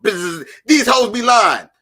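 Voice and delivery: loud, slow, hammed-up buzzer call; mock buzz-in shout. Contestant buzzing in on a picture puzzle, shouting. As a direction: slam the buzzer and yell it out. Acting a game show buzzer.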